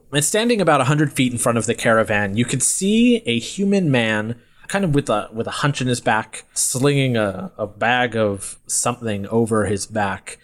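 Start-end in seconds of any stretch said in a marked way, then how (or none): none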